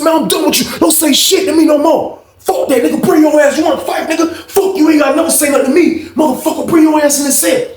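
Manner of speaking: high-pitched, fuming mimicked outburst; heated confrontation. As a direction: dare him to fight, voice way up high. Acted quarrel before a fight.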